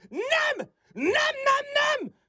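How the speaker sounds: high, shouted denials; vehement refusal. Acted. Woman refusing her rival's beer and sticker, vehement refusal. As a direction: burst out in rising refusal. She refuses to be won over.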